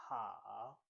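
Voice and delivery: HOW dipping low, then rising; third tone. Shown as a demonstration. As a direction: Mandarin third tone on HOW, falling then climbing. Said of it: "the third one".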